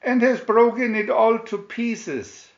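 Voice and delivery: put-on Baby Bear upset over his broken chair; wee Baby Bear voice. A narrator's reading. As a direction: wail it like a tiny cub. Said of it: "said the Baby Bear in his wee voice".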